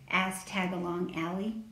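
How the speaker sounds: ordinary delivery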